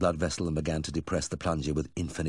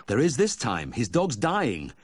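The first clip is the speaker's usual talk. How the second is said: urgent alarm; 6 semitones higher, 4 dB louder, slower than usual. Acted. Vet urgently rushing to dress.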